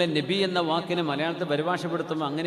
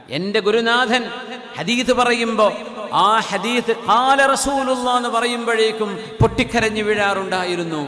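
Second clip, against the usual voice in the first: raised, sustained sermon voice; impassioned preaching; 7 semitones higher, 6 dB louder than usual. Acted. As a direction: build to a loud, intoned climax. Preaches the teacher's words.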